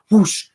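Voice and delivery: loud, high rushing whoosh; whoosh sound. Retold or imitated. Imitating soul rushing back.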